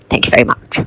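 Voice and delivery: fast put-on customer voice; customer character voice. Shown as a demonstration. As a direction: rattle it off in the customer's voice. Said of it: "this is the voice I do for the customer".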